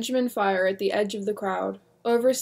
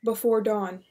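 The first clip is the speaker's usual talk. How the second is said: usual speaking voice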